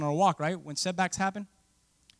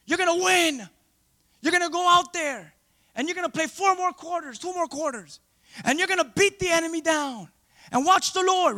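high, pep-talk Moses rallying the team; Moses the encourager; 9 semitones higher, 4 dB louder than usual. Retold or imitated. Moses encouraging frightened Israel, high pep talk. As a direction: pump them up like a coach before the game. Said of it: "What an encourager Moses".

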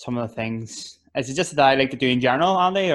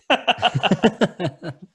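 laugh; 8 semitones higher, 4 dB louder than usual. Real, not acted. Laughs at own joke.

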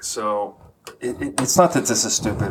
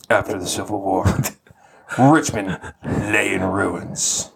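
Jocko Willink. Acted. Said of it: "You've gotta read this like Jocko Willink".

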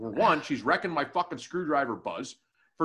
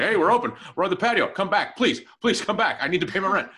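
louder, higher begging bartender; mock pleading; 4 semitones higher, 6 dB louder than usual. Bartenders begging their regular to come back, mock pleading. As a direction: imitate a desperate plea for business.

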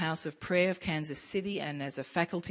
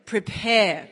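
louder, bigger Prepare; big call; 4 semitones higher, 9 dB louder than usual. Shown as a demonstration. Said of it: "big one".

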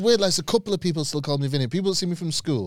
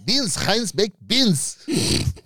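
put-on high, drawn-out Spanish-accented delivery; hammed-up Spanish accent, swooping high; mock Spanish accent; 6 semitones higher, much slower than usual. Mocking Spanish pronunciation of his name.

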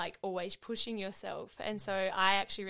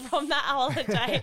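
louder, higher, laughing; 6 semitones higher, 10 dB louder than usual. Real, not acted. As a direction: giggle through the words. Laughing at own weight gain.

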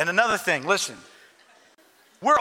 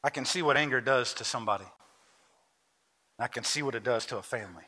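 quiet altar appeal; 9 semitones lower, 7 dB quieter than usual. Acted. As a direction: draw them in gently, heart to heart.

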